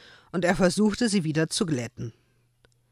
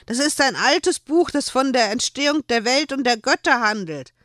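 higher, louder indignant reproach; angry; 8 semitones higher, 5 dB louder than usual. A narrator's reading. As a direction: scold him, upset. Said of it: "Joel war nun auch wütend".

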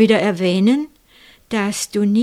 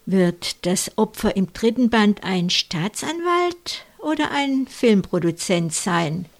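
usual speaking voice

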